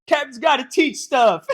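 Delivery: loud, slow goofy henchman echo; dim sidekick shout. Retold or imitated. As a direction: bellow it like a dopey sidekick. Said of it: "he goes".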